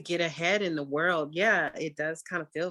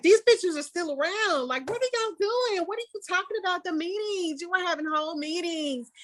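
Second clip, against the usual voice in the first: high, incredulous retold rant; exasperated, much higher retelling of her reaction; exasperated disbelief; 11 semitones higher than usual. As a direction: throw it up high, half-mocking outrage. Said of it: "So I was like".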